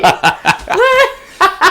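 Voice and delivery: high laughing call; laughing cheer. Real, not acted.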